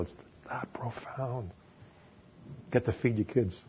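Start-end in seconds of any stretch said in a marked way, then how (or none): 0.0-1.5 slow breathy whisper for effect; a hushed whisper to land the point. Acted. Preacher drops to whisper.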